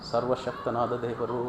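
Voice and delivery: soft, low reverent echo; acted low, hushed confession of faith; hushed awe. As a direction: let it sink down in quiet wonder. Same words repeated hushed after proclamation.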